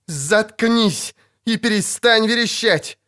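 slow, high-pitched irritated rebuke; angry snap. A narrator's reading. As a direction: silence him with drawn-out irritation. Irritated sorceress silences witcher. (раздражённо, с растяжкой одёрнуть)

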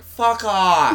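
mock American accent, high and loud; exaggerated American "fuck off", drawn out; American swearing. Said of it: "hear Americans swear, being like".

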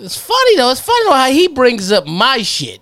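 mock indignation. Real, not acted. Indignant high exclamation.

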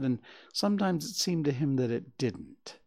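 plain reading voice

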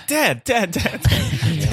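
frantic high calls for Dad; panicked calling out. Acted. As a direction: cry out Dad in mock panic.

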